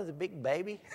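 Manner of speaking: ordinary delivery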